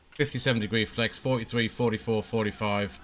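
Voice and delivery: usual speaking voice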